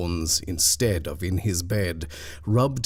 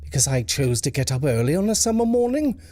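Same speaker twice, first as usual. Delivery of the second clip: high, fast, forced question; strained effort; 8 semitones higher, 4 dB louder, faster than usual. Acted. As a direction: push the words out with effort. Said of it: "asked Mister Templeton with an effort".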